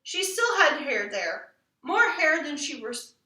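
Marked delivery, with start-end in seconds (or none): none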